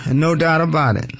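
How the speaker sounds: usual speaking voice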